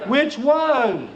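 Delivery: complaining guy at home. Acted. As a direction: put on a guy griping about his missing underwear. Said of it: "Mostly guys say this".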